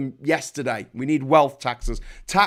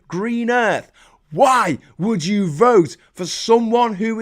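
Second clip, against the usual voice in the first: real indignant tirade, pitched up; outraged rant; 7 semitones higher, 5 dB louder than usual. Real, not acted. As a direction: rail at them, drawing out each word. Outraged rant at party's voters.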